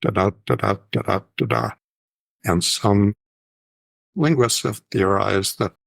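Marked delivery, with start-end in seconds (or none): 0.0-1.7 "da-da" with weak first beat, strong second; "da-da" in even unstressed-stressed pairs; iambic rhythm. Shown as a demonstration. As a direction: beat out each "da-da" as short-LONG. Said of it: "but the iambic rhythm".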